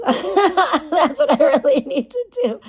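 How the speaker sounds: slow laughing line. Real, not acted. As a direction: laugh through the words. Laughing while joking.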